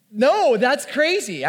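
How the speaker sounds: alarmed refusal. Retold or imitated. Refusing the swinging rope.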